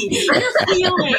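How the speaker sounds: high-pitched mock delight; gleeful celebration. Sponsor rejoicing that her girl is feeling, gleeful. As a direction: cheer it with a laugh, as retold.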